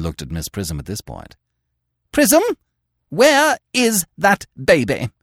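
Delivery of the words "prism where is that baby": high, loud, slow imperious demand; Lady Bracknell thundering at the governess; stern dowager demand. Acted. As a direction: summon the governess to account.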